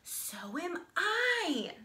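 a tiny tadpole proudly chiming in; stretched-out high little "So am I"; small pollywog voice. Acted. Little pollywog answers the boast.

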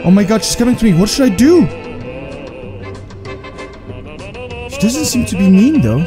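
high, panicky nervous voice; scared horror game streamer. Real, not acted. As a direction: nervously fret as she comes closer. Girl approaches in horror game.

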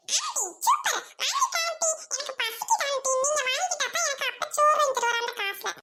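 put-on high, pleading little boy; hungry child voice. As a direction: beg her like a hungry kid. Child asking for food. (பசிக்கிறது என்று கெஞ்சும் சிறுவன் குரல்)

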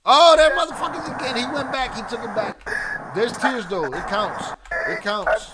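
loud, high burst of insistence; excited protest. Real, not acted. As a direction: call it out like a disputed score. Host insists the tears count.

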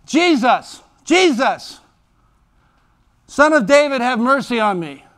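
high, loud desperate calling; a blind beggar shouting for help over the crowd; crying out. Acted. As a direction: call out to Jesus as if he's far away. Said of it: "He began to cry out and say".

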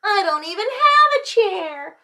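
high, loud protest; storybook child's outcry; shouting child. A narrator's reading. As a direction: wail like a wronged little kid. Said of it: "shouted little Chan".

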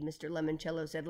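ordinary delivery

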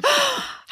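high, sharp intake of breath; mock thrilled gasp. Wife delighted at husband's quote, dramatic gasp. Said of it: "I'm like".

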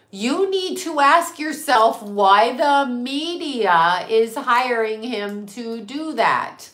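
drawn out word by word; slow, pointed speech. Real, not acted. Warning the audience about who gives advice, slow and pointed. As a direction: spell it out slowly and emphatically. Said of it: "Listen to me talking".

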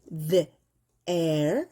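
The and air said apart, slowly; sounding out. Shown as a demonstration. Teacher splitting there into parts for students. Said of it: "there 이렇게 할 때요".

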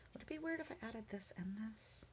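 soft whispered question; whispering. Real, not acted. Whispering to herself while choosing tape.

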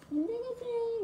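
speaking through a covered mouth. Shown as a demonstration. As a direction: hand over mouth, high pitch. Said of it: "입을 이렇게 막고".

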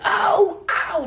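high sharp cry of pain; pained ouch. Shown as a demonstration. Mother showing how you flinch from birth pain, sharp cry. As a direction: yelp it out like it burns.

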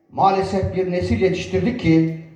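ordinary delivery

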